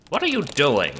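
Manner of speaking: high, loud call at the screen; loud question. Acted. Asking the child what they're doing, loud shout.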